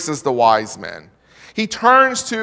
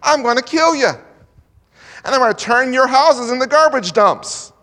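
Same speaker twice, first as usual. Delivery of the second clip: higher, louder mock threat, slowing at the end; the king threatens his wise men; threatening king; 6 semitones higher, 4 dB louder than usual. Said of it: "he says".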